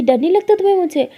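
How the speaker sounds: high, loud taunting question; challenging question. Retold or imitated. Lily asking Lakshmi if she fears her, loud taunt.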